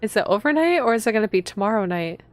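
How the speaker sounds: ordinary delivery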